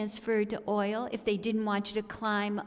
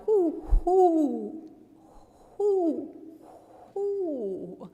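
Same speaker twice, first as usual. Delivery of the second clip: put-on higher, louder drunken owl hooting; 6 semitones higher, 3 dB louder, much slower than usual. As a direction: hoot like a tipsy barn owl. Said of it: "a barn owl impersonating an alcoholic".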